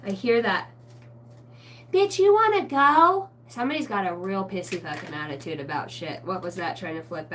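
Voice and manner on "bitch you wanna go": high, loud, slow taunting dare; mock challenge. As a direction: throw down the challenge like a spirit picking a fight. Voicing a spirit picking a fight.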